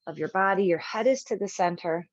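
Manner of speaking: usual speaking voice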